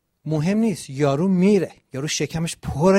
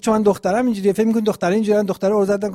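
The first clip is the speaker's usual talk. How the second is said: higher, louder and fast, indignant; retelling the girl who took offence, fast and raised; mock offended girl; 5 semitones higher, 5 dB louder, much faster than usual. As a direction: play the girl huffily protesting, pitch up. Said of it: "یه دختری بود. گفت".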